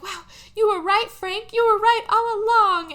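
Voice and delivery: hammed-up amazed admission, high and loud; Lady Bird. Lady Bird conceding to Frank, loud high mock amazement. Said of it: "she's like".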